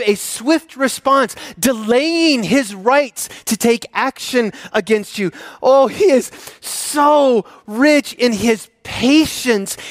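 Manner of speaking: lavish each phrase with awe; fervent preaching. Acted. Preacher reading his own translation of God's patience, fervent and slow.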